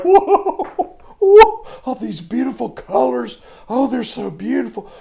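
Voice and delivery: high, loud gasp of wonder; awestruck little girl. Retold or imitated. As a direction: marvel at the colors like a child. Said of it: "she's just like".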